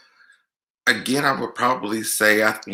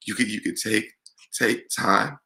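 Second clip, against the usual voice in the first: choking up; 3 semitones higher, 5 dB quieter than usual. Real, not acted. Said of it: "I did not come here to cry".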